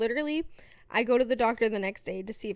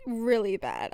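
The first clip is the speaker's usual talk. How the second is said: normal talking voice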